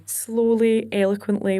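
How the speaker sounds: drawn-out, careful enunciation; slow, eloquent. Shown as a demonstration. Singer showing how she must address crowds, slow eloquent delivery. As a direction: stretch each word out carefully. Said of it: "which is not me".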